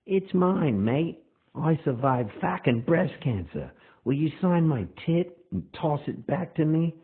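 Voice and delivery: high, loud Aussie woman's voice; Australian accent. Retold or imitated. Fan asking singer to sign prosthetic breast, Australian accent. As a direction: mimic a brash Australian woman. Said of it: "with a thick Australian accent".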